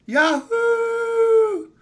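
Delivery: high, loud whoop of joy; cheer. Real, not acted. Cheering about pranks.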